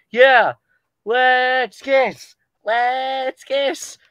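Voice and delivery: high, loud gleeful cheer; excited shout. Pretend. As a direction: yell it out with glee. Character cheers loudly.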